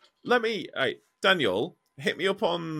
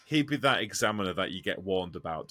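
usual speaking voice

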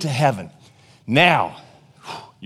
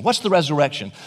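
ordinary delivery

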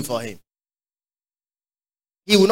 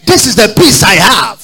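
shouted declaration; 3 semitones higher, 9 dB louder than usual. Real, not acted. Preacher shouting his peace to the congregation. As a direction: belt it out to the church.

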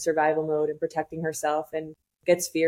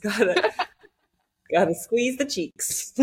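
laughing; 5 semitones higher, slower than usual. Real, not acted. Joke about holding it in.

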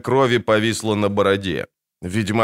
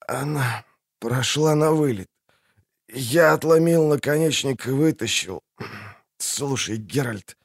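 wounded soldier; 3 semitones higher than usual. Acted. Man with pierced lung.

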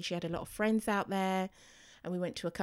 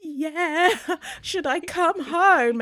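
high, loud, half-laughing tearful plea; crying on the phone; 10 semitones higher, 11 dB louder, slower than usual. Retold or imitated. Mum in Germany begging to fly home, crying and laughing loud. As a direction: wail it through tears with a laugh breaking in. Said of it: "I'm on the phone crying".

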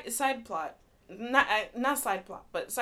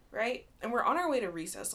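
normal talking voice

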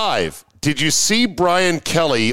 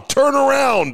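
shouted warning; 13 semitones higher than usual. Pretend. Imagined sign shouting warning.

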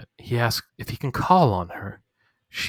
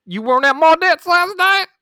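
mock sheriff; 9 dB louder than usual. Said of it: "he's like".

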